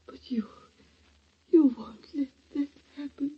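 faint, breathless whisper with catches of crying; weak dying whisper. Acted. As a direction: speak as a frail dying woman. Dying aunt's feeble whispers.

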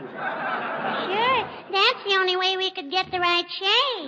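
put-on high, sweet child's voice, sing-song; little girl voice. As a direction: play a cheeky little girl. [name] plays little girl Teeny.

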